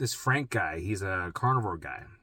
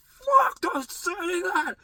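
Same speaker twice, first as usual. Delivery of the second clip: 1 panicked yell; 3 dB louder, much slower than usual. Retold or imitated. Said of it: "I'm like".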